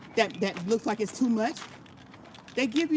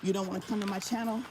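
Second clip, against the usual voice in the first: normal talking voice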